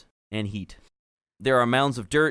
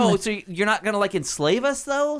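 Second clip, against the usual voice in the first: put-on valley girl gnome; 13 semitones higher, 5 dB louder than usual. GM voices gnome Clotilda.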